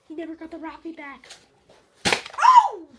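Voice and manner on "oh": surprised yell. Real, not acted. Surprise in game.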